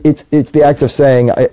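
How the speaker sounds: ordinary talk, nothing marked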